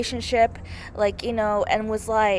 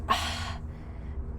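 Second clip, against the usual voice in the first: long breathy sigh; heavy sigh; 10 dB quieter than usual. Real, not acted. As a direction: let out a long, emotional exhale. Overcome talking about the character.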